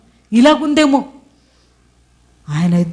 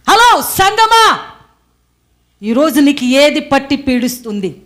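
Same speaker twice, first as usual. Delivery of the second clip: fervent preaching; 14 semitones higher than usual. Real, not acted. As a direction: challenge the crowd fervently. Preacher exhorts at full force.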